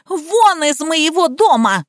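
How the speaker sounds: slow, high-pitched furious order; unhinged. A narrator's reading. Liza throws Nika out, hysterical. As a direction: drive her out with a high cry. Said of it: "окончательно съехала с катушек Лизавета".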